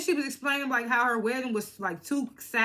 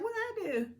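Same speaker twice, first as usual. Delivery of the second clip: high-pitched protest of innocence; mock innocence; 12 semitones higher, 4 dB quieter than usual. Imitating a cast member playing innocent, high mock protest. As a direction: squeak out an innocent question.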